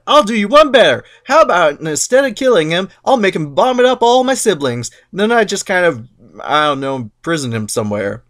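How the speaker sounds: one-up mom casually; Zeus bargaining. Retold or imitated. Zeus countering his mother, cocky. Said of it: "And Zeus is like".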